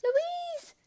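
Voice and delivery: very high joyful shout; overjoyed to see her; high, loud cry of relief; relieved cry. A narrator's reading. Relieved reunion with sister.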